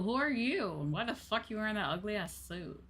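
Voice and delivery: ordinary talk, nothing marked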